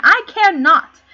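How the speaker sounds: loud defiance. Acted. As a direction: fling it back at the king. Defiant reply to the king's threat.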